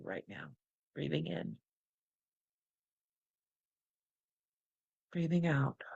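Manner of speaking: soft breathing cues, fading on the out breath; guided breathing. Acted.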